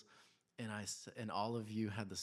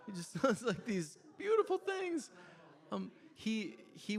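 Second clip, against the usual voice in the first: laughing through the words, higher and louder; 13 semitones higher, 7 dB louder than usual. Real, not acted. Father delighting in his son's antics, laughing. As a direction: giggle while you say it. Laughing while describing son.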